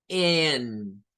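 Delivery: "N" said as en, with an open e; English "N". Shown as a demonstration. Teacher modelling the letter name "N". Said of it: "lleva la A E".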